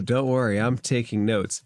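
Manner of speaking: ordinary delivery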